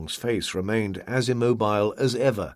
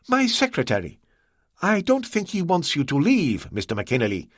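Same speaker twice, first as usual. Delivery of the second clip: higher, courteous voice of the host; Ah Wong, polite host; 6 semitones higher than usual. A narrator's reading. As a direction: play the composed, obliging host, pitched up.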